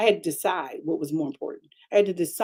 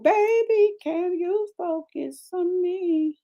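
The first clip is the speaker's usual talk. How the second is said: put-on soothing baby talk; 11 semitones higher, much slower than usual. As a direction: coo gently to the baby.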